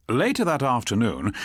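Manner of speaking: ordinary delivery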